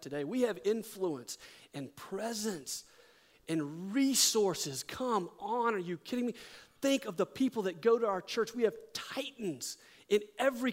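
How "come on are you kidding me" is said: ordinary talk, nothing marked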